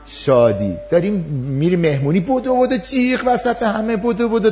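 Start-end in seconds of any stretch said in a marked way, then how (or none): 2.2-4.5 high, lively running call; a child racing about the party; excited child. Acted.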